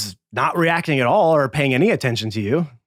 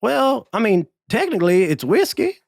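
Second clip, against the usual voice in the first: much higher, evasive squeak; high squeaky bartender; 10 semitones higher than usual. Acted. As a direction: squeak it out, caught in a lie.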